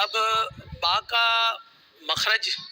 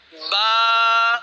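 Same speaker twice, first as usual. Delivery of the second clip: ba held and called out louder; loud letter; 4 dB louder than usual. Shown as a demonstration. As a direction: sound out ba loudly for the class. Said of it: "آواز جیسے آپ نے اونچی کی". (با اونچی آواز میں)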